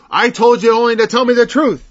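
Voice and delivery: a king scolding his prophet, loud; angry king. Retold or imitated. Ahab rebuking Micaiah for his prophecy, loud and angry. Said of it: "the king got angry and he said".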